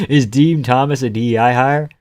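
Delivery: ordinary talk, nothing marked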